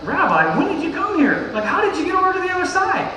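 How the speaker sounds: high and fast, half-amused disbelief; baffled crowd. Retold or imitated. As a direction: act out the confused crowd. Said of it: "and they was like".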